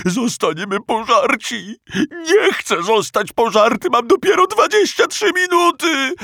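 high, loud panicked howl; pitiful wail. Acted. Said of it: "Rozdarł się stwór żałośnie".

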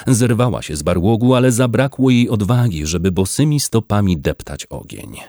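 ordinary delivery, nothing marked

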